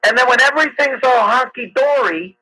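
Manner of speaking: normal talking voice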